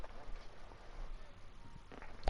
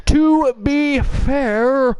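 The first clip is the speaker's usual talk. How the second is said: drawn out; 10 semitones higher, much slower than usual. Acted. Drawn-out emphatic repeat.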